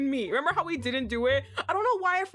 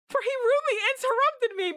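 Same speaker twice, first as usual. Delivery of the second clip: much higher, louder, fast mock outrage; high indignant repeat; 11 semitones higher, 3 dB louder, faster than usual. Shown as a demonstration. Re-reading the line after the interruption, higher and louder. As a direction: squeal it in mock offence.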